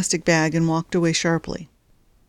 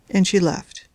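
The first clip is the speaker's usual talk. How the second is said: usual speaking voice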